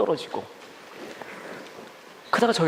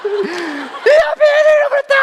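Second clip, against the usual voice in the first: slow, piercingly high shout; mock-hysterical mother screaming; frantic calling out; 8 dB louder, slower than usual. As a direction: bellow it for everyone to hear, dragged out. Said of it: "화를 내고 또 계속 불러".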